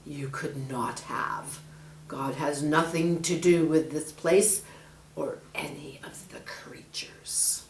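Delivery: menacing snake. Acted.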